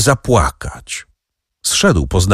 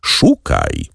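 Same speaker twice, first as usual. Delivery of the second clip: much lower, slow "Szukaj, szukaj" as a low taunt; low urging; slower than usual. A narrator's reading. Count taunting the prosecutor to remember, low urging. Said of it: "rzekł hrabia".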